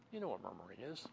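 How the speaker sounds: usual speaking voice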